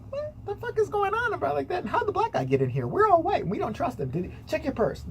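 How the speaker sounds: lightly voiced indignant onlookers, pitch jumping high; hint of scandalized bystanders; mock alarmed teachers. Teachers reacting to the officer, mock alarm.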